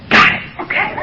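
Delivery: whispered, chastened reply; sheepish whisper. Retold or imitated. Answering his sponsor from the back, sheepish whisper. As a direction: whisper it, caught out.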